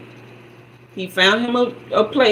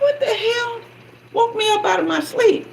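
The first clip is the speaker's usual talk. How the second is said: startled annoyance; 14 semitones higher than usual. Retold or imitated. Woken up, annoyed quote.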